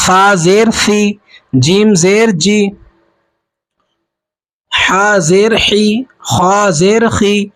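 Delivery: slow drill, each of فی، جی، حی، خی ending in "ee"; measured teacher's recital, long "ee" in فی، جی، حی، خی; ma'ruf vowel. Shown as a demonstration. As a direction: draw out each spelling with a pure "ee" on فی، جی، حی، خی. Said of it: "یعنی اس طریقے سے پڑھا جائے".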